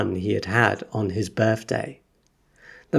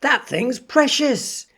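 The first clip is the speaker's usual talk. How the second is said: wildly excited; 13 semitones higher than usual. Acted. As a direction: announce it to the whole shop. Shopkeeper still overexcited.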